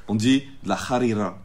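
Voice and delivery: harira with guttural h and rolled r; Arabic pronunciation. Shown as a demonstration. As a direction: pronounce harira the real Arabic way. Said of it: "les vrais arabes tartah".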